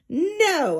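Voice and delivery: high, sharp mock shriek; a mild, hammed-up shriek. A narrator's reading. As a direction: squeal out the refusal. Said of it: "I shrieked".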